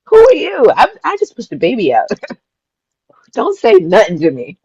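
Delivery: high, sassy playful challenge; hammed-up indignant swagger, pitched high; mock defiance. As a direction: boast like nobody can tell you anything, laughing. Playful boasting after giving birth.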